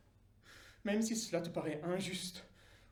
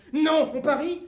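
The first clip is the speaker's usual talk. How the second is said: défi moqueur; 7 semitones higher, 13 dB louder than usual. Acted. Dieu défie le diable.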